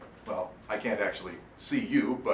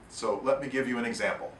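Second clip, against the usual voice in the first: plain reading voice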